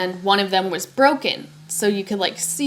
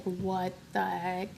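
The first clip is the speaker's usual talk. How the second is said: very soft, slow, stunned; re-enacting shock, barely voiced and drawn out; stunned disbelief; 2 semitones lower, 13 dB quieter, slower than usual. Learning they saw her ex leave, quiet stunned disbelief.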